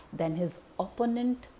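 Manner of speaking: ordinary delivery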